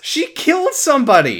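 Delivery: high, loud, almost squealing; excited gasp of disbelief; shocked excitement. Real, not acted. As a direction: exclaim it in thrilled shock.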